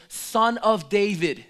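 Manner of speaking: slow, loud messianic call. Retold or imitated. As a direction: call out Son of David slowly. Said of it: "he says".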